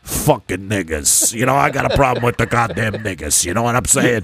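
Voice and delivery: Brooklyn driver voice. Retold or imitated. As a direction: play the pushy New York limo driver. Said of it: "you got a really funny voice".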